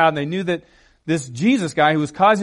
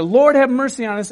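raised, higher plea, short of shouting; retold shout; 5 semitones higher, 5 dB louder than usual. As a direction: call out as the blind men, half-strength. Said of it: "two blind men shouted".

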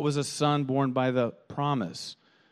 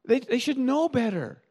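high, teasing disbelief; mock incredulous; 10 semitones higher than usual. Couple joking about foolish choices, high and mocking. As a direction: tease the people who should know better. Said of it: "we'll say".

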